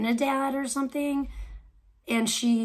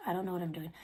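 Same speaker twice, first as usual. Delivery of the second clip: normal talking voice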